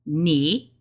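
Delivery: "Ni" rising in Mandarin second tone. Shown as a demonstration. Showing learners the tone rule, "Ni" in rising second tone. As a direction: say "Ni" with pitch climbing upward. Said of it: "so we know this is second tone".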